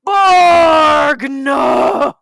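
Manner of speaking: long, high, hammed-up cry into the ether; anguished shout. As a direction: shout it dramatically, stretching each word. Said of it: "I'm shouting into the ether".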